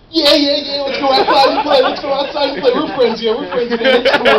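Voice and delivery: high, loud, bouncing excitement; happy dog. Pretend. Forgiven dog begging owner to play, high and excited. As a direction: burst out like an overjoyed puppy. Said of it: "they're like".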